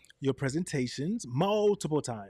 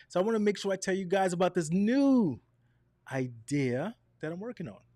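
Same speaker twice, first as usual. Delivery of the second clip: higher, louder and bouncing with enthusiasm; lively inflected demo; 5 semitones higher, 3 dB louder than usual. Shown as a demonstration. Teacher showing viewers lively tonality, enthusiastic. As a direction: sell the new idea with energy. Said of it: "See, even that was that pace and that tonality".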